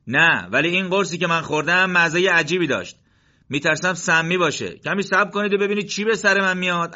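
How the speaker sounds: lively back-and-forth over the pills; soldiers bantering. Acted.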